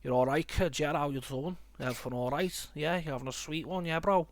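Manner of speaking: imitating a Scouse accent, lightly; a light Scouse impression. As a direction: chat like a Liverpool lad in Scouse. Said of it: "the Scouse accent is actually like".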